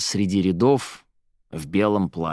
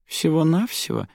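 brief, unimpressed question; languid, unimpressed; 8 semitones higher than usual. A narrator's reading. Aradia responds to Zaira's boast, languidly unimpressed. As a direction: ask as if it barely interests you. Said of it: "вяло спросила Арадия".

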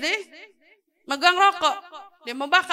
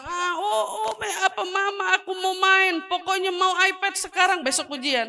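put-on higher, whimpering kid begging for the iPad; whiny child; 5 semitones higher than usual. A child whines to mama before the exam, higher and whimpering. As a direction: whine like a spoiled child who wants to play. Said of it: "Dia bilang".

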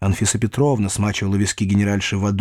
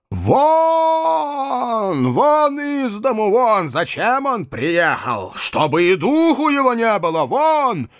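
put-on high, wailing old lady's shriek; furious cry; 11 semitones higher, slower than usual. A narrator's reading. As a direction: throw them out, stretching each shout. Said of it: "крикнула она, притопнув на меня ногою".

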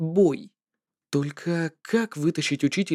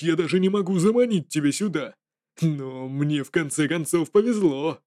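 sinister jester voice. Acted. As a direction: gloat like a cruel trickster. Jester gives two options.

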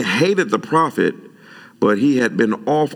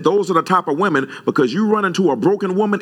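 heated retort; 8 semitones higher than usual. Retold or imitated. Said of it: "Yeah, I said".

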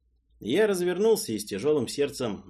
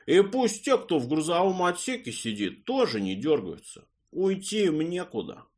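nasal drawl; 5 semitones higher than usual. Acted. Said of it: "гнусавым голосом протянул Васенька".

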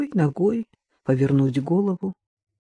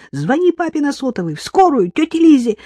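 high and loud, building; panicked orders; 10 semitones higher, 5 dB louder than usual. A narrator's reading. Marina ordering her brother to call for help, shouting. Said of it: "закричала Марина".

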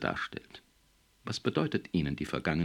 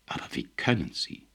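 voice failing; 2 semitones higher than usual. A narrator's reading. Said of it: "Fosters Stimme versagte".

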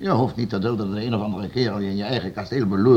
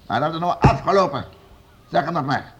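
raised, higher final warning; stern threat; 6 semitones higher, 4 dB louder than usual. Acted. Lord warning the hidden hermit, stern and raised. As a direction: lay down the law.

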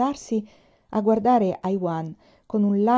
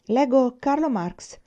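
slow, higher, mocking defiance; sarcastic; 6 semitones higher, 4 dB louder, slower than usual. A narrator's reading. Aiwan taunting the soldiers about his book, sarcastic. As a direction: drawl it as a taunt. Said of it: "aveva risposto con sarcasmo".

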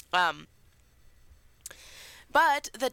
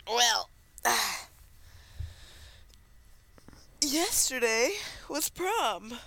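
stretching groan; 4 semitones higher than usual. Real, not acted. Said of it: "sorry, I'm stretching".

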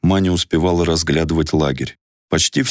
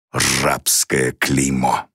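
very slow hissed words; through clenched teeth; 3 semitones lower, much slower than usual. A narrator's reading. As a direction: spit it out slowly, through the teeth. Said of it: "процедил он".

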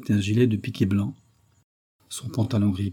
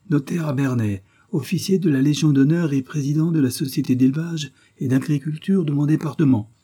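put-on high, fluting dignified old man; pompous old notary; 6 semitones higher than usual. A narrator's reading. Notary listing titles to the commissioner, offended dignity. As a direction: announce your titles in a high, haughty voice.

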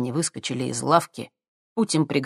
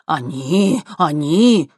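eager; 2 semitones higher, 6 dB louder, much slower than usual. Acted. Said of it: "закивал владелец ломбарда".

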